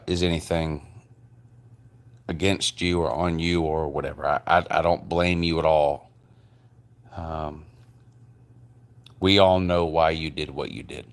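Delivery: ordinary delivery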